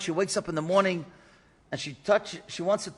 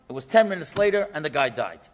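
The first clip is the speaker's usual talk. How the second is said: ordinary delivery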